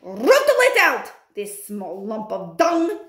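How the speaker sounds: high, loud witch scream. Acted. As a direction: scream the order. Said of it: "screamed the Grand High Witch".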